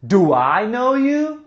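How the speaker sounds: slow, rising do I know you; slow question. Shown as a demonstration. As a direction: ask it slowly and clearly. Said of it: "você vai falar assim".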